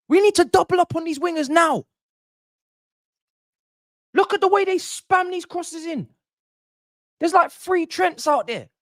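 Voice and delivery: high-pitched, exasperated yelling at the team; furious rant. Real, not acted. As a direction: yell high at defenders who won't learn. Ranting at defenders over crosses.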